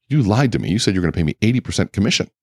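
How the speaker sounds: a mock outburst at being cheated; retold angry accusation. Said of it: "I started getting angry with him".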